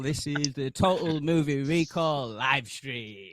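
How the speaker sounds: big, drawn-out announcer opening; epic intro. Pretend. Host greeting livestream viewers with an epic announcer intro. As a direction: belt out the show's name, slowing right down. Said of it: "that epic, uh, intro".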